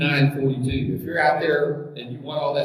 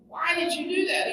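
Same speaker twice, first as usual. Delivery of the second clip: mock dying question, pitched way up; dying duck; 11 semitones higher than usual. Stung duck asks the scorpion why, high mock-dying voice. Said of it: "while the duck is dying, he's going".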